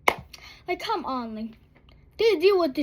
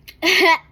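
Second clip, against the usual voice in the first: burst of laughter; 5 semitones higher, 7 dB louder than usual. Real, not acted. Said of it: "dying laughing".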